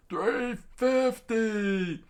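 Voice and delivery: very slow, high-pitched holler; long wailing falsetto call; drawn-out cry. Acted. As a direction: stretch each word out high. Acted character's cry.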